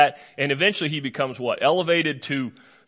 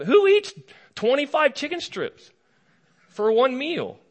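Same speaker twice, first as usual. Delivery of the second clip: much higher, disbelieving who eats; incredulous; 10 semitones higher than usual. Real, not acted. Preacher marvels to the congregation at the huge portion, much higher. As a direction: rise high in amazement.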